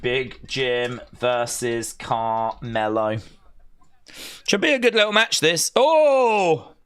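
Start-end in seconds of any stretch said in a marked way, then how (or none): 0.0-3.2 slow, soft ring announcer drawl. Acted. Streamer announcing the match like a ring announcer. 5.8-6.7 surprised oh. Real, not acted. Reacting to match.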